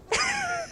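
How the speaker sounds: short high burst of a laugh; incredulous laugh. Real, not acted. Pastor recalling the parents' absurd answer, a high incredulous laugh. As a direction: laugh in disbelief.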